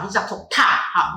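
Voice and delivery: ordinary talk, nothing marked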